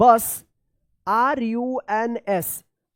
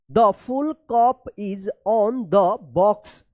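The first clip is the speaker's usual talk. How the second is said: The full cup is on the box read very slowly, word by word; slow reading aloud; much slower than usual. Shown as a demonstration. Teacher reading a practice sentence to pupils, very slow. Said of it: "चलिए अब यहाँ पे देखिए".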